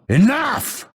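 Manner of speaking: very high-pitched outburst; exasperated, high combat cry; angry battle cry. Acted. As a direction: cut the fight short with a high cry. Cutting off a foe in combat.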